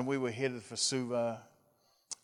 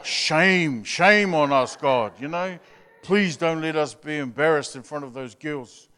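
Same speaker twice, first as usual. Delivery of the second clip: put-on boys' pleading prayer; 7 dB louder than usual. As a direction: start casual, then plead loudly against shame. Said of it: "And the boys are".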